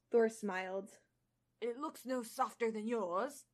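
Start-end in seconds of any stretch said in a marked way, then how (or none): 1.6-3.4 put-on a touch of an Australian accent. Reader voicing Thor in a faint Australian accent. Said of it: "still attempting it".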